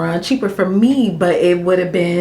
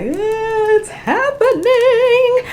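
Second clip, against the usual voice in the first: nervous; 13 semitones higher, 5 dB louder, much slower than usual. Pretend. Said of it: "I'm just kinda nervous".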